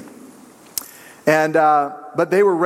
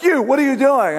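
high, louder mock call-out; mock yell; 9 semitones higher, 3 dB louder than usual. Pastor pretends to scold a sleeper, mock yell. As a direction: pretend to snap at a sleeper, much higher. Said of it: "I'm not gonna be like".

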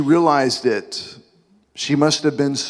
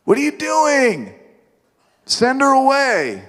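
much higher, slow, squirming complaint; embarrassed disciples; 4 semitones higher than usual. Acted. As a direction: whine it like a flustered follower. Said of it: "The disciples are like".

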